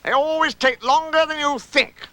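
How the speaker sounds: high-pitched know-all voice; high character voice; Rabbit. Acted. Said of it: "said Rabbit".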